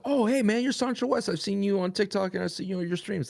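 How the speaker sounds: high-pitched imitation of a fan; put-on high voice of an eager passer-by; excited fan. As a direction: play a fan spotting someone they recognize. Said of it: "that's when people go".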